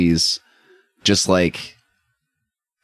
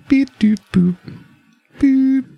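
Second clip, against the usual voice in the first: high beeping; slower than usual. Acted. Anton presses settings buttons, high beeping noises.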